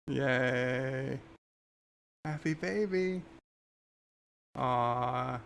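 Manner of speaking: drawn-out, hushed little cheer. Real, not acted. Uncle cooing at baby nephew, tender baby talk.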